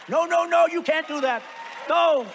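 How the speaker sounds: high, loud panicked cry of no; alarmed protest. Retold or imitated. Macron hearing the wine tariff threat, panicked loud protest.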